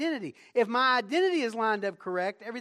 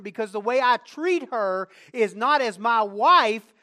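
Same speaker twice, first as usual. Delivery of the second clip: louder, higher pulpit drive; raised sermon delivery; full-force preaching; 3 semitones higher, 8 dB louder than usual. Acted. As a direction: preach it out to the whole church. Sermon climax.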